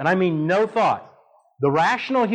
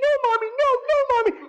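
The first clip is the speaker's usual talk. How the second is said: much higher imitated child's frightened cry; screaming little boy; faster than usual. Boy pleading with his mother in the bee swarm, high child cry. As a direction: pitch it way up like a terrified toddler. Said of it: "he's screaming".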